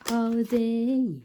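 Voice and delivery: dreamy. Real, not acted. Dreaming about a holiday.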